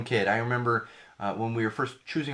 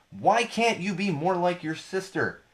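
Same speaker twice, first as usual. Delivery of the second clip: mock nagging parent; 7 semitones higher than usual. Acting a comparing parent.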